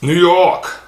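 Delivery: a quick hammed-up New York twang on "New York"; bad New York accent. Sneaker vlogger naming his shoes, mock New York accent. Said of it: "It's a bad New York accent".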